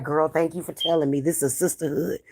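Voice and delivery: soft, low, sugary fake thanks; sarcastic sisterly sweetness, hushed; sneering parody of a grateful friend; mock sweet gratitude. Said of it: "I'm not gonna be like".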